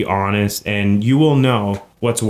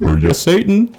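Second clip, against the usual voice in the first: laughing; 5 semitones higher than usual. Pretend. Mock answering Satan, laughing.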